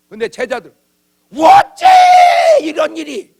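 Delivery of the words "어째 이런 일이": very high, loud exclamation of alarm; shocked cry. Retold or imitated. Disciples see weeds in the field, crying out in shock. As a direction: cry out in disbelief.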